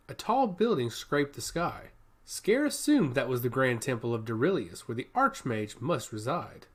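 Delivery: ordinary delivery, nothing marked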